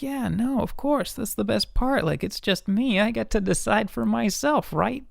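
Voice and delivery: high, breezy, carefree; high mock-casual voice. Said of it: "And I was like".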